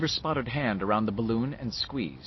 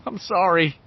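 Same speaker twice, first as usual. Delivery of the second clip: high, loud tearful apology; nearly crying; 7 semitones higher, 5 dB louder than usual. Acted. Boy apologising to the druggist, nearly crying. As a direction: apologise on the verge of tears. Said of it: "he said, nearly crying".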